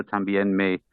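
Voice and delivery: ordinary talk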